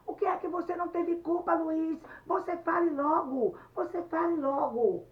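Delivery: high, very loud demands; angry shouting. Acted. Anete orders Luiz to speak up, shouting furiously. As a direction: shout at him to confess at once. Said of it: "E ela estava gritando".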